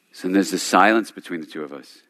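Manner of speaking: ordinary delivery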